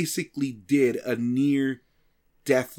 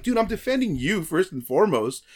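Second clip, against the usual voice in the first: high exasperated laughing plea; laughing protest; 6 semitones higher, 5 dB louder than usual. Retold or imitated. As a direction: plead while laughing.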